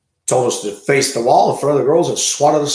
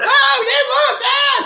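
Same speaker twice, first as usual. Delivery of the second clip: shouted much higher and louder, a mocking put-on voice; mocking shrill shout; 6 dB louder, slower than usual. Mocking offended women who shame gym guys, high shout. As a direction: sneer it out as an offended woman.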